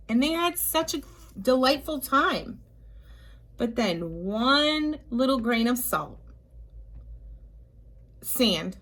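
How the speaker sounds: ordinary delivery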